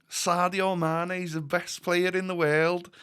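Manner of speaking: high, slow put-on Scouse; Liverpool accent. Shown as a demonstration. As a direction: do a stretched-out Scouse impression. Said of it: "Liverpool accent, by the way".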